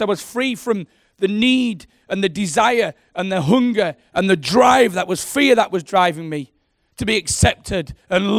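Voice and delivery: loud, ringing sermon delivery, building with passion; full-force preaching. Acted. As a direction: preach it out to the whole room.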